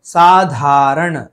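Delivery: साधारण slow and loud with puffed धा; aspirated dh. Shown as a demonstration. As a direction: stretch "saa-DHAA-raṇ" in साधारण. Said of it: "सही है".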